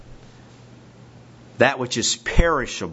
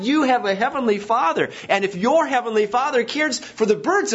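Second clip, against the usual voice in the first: higher, urgent preaching lift; fervent preaching; 9 semitones higher than usual. Acted. Pastor assures the congregation of God's care, fervent.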